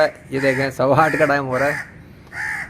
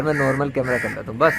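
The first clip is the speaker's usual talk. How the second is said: ordinary talk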